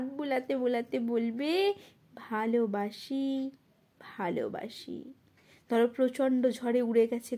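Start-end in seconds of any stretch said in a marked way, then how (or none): 2.1-5.1 soft, slow, lowered confession of love; tender reply. Acted. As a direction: answer tenderly, sinking softer on the repeat. Tender answer in the poem's refrain. (কোমল, নিচু স্বরে)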